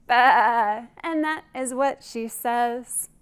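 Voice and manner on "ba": bleat. Shown as a demonstration. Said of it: "what does she say".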